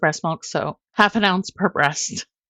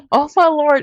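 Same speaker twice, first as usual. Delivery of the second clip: exasperated; 9 semitones higher, 7 dB louder than usual. Real, not acted. Exasperated at the memory.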